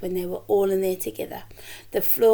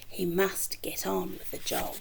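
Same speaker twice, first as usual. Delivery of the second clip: soft whisper to the listener; conspiratorial storyteller whisper; whispered aside; 4 semitones lower, 9 dB quieter than usual. A narrator's reading. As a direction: hush it like a secret plan. Narrator hushes, unvoiced.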